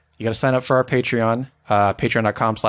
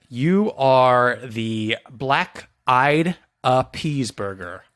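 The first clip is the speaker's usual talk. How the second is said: slow pun reveal; much slower than usual. Pretend. Host unveiling a subscriber's burger pun, slow reveal.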